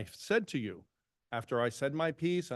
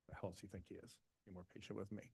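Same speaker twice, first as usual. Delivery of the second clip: muttered under the breath, much softer, in disbelief; indignant mutter; 4 semitones lower, 19 dB quieter, faster than usual. Real, not acted. Trustee mutters about a colleague's jab, indignant and much softer. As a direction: grumble it half to yourself, offended. Indignant aside after quoting rival.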